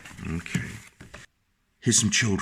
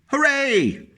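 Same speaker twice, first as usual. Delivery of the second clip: villagers cheering; 14 semitones higher than usual. A narrator's reading. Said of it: "villagers shouting".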